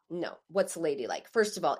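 normal talking voice

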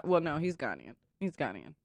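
low, hushed correction; very soft, low-pitched confidential aside; shady aside. Real, not acted. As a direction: drop it down like something shady. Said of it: "say it like it's shady".

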